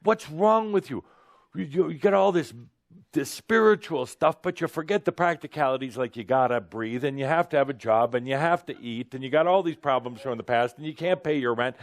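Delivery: the human side scolding the spiritual self, mock yell. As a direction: rattle off the nagging complaints. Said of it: "It's the human screaming out".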